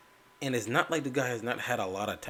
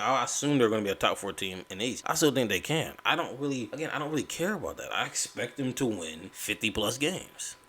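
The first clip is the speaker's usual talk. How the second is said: ordinary talk, nothing marked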